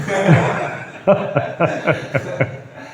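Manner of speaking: airy laugh at the punchline; breathy laugh. Real, not acted. After telling the Madagascar story, breathy laugh.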